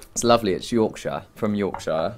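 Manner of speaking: broad Northern English Yorkshire accent. Shown as a demonstration. Guest showing the host his dad's northern speech, Yorkshire accent. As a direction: say it like someone from Yorkshire. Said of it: "I'll do the Yorkshire accent".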